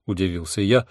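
ordinary delivery

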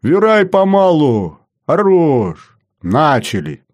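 shift chief's command. A narrator's reading. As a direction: call orders across the workshop. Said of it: "скомандовал начальник смены".

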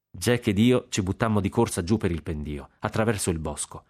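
usual speaking voice

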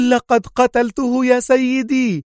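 spy bursting in to announce her kill; joyful cry. A narrator's reading. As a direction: cry it out in jubilant triumph. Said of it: "صاحت وهي تقفز في حبور".

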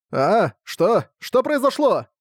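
baffled what-happened cries; confused exclamations. Acted. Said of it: "сконфуженные возгласы".

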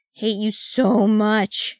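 long, whiny lament; moan. A narrator's reading. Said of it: "he moaned".

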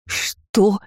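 sharp high growl of disbelief. A narrator's reading. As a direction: snarl the question, pitch jumping up. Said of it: "зарычала Ролана".

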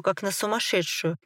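usual speaking voice

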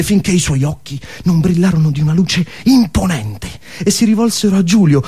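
ordinary talk, nothing marked

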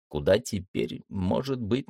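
plain reading voice

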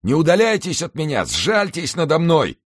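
drowning man crying out, loud and high; desperate cry. A narrator's reading. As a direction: scream for mercy from the water. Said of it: "кричал молодой человек".

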